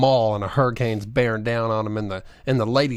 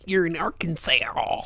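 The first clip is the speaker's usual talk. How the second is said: drawn-out, higher mock Southern drawl; slow, hammed-up Southern twang; mock Southern accent; 6 semitones higher, slower than usual. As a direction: imitate a movie's bad Southern accent. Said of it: "they're like".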